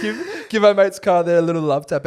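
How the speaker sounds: egging on the Uber driver, loud and high; called-out request. Retold or imitated. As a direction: call it up to the front seat. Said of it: "I said".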